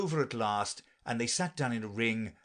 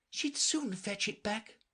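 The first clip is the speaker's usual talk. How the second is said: put-on slow, high girl's voice; Alice's voice; 10 semitones higher, slower than usual. A narrator's reading. Alice talking aloud about Dinah, high and slow. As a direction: say it high and unhurried.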